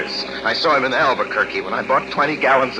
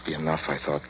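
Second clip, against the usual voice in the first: softer, lower inner monologue; hushed longing; 8 semitones lower, 11 dB quieter than usual. Acted. Son thinking of his mother, soft and low. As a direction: drift into a quiet, longing memory.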